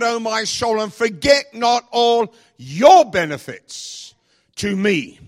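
loud, high, slowly proclaimed prayer; ringing preacher's declaration of praise; fervent praise. Acted. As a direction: proclaim it to heaven, stretching the key words. Models a morning prayer of praise.

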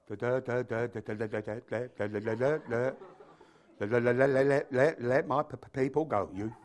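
low, soft mock stutter; stuttering Moses. Reluctant spokesman confronting Pharaoh, acted low stutter. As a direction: stammer on repeated syllables before getting the words out. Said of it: "they say Moses stuttered".

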